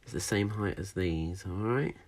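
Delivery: ordinary talk, nothing marked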